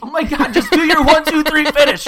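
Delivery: high, loud, exasperated cut-in; impatient shout. Real, not acted. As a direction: yell at them to hurry up. Impatient host cutting in.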